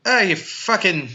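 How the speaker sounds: high, hammed-up Boston rant; mock Bill Burr Boston rant; Boston accent. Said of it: "you just do a Bill Burr".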